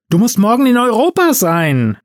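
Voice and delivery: high-pitched exasperated scolding; ranting. A narrator's reading. Elf scolding Santa the night before Europe, exasperated. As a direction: scold him in alarm about his duty. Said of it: "wetterte Marcel".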